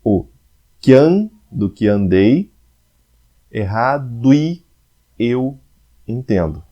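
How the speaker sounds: "do que andei, errado e eu entendo" drawn out slowly, sounds run together; slow, scanning the verse syllable by syllable; syllables joined slowly. Shown as a demonstration. Teacher showing students how syllables merge, slowly. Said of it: "Então eu junto aí".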